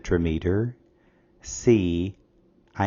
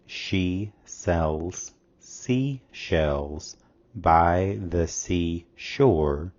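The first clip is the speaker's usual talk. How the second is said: very slow demo; much slower than usual. Shown as a demonstration. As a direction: spell out the alliteration word by word, very slowly. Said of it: "As you can see in the example".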